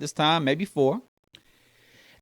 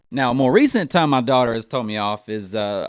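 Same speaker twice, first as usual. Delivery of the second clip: normal talking voice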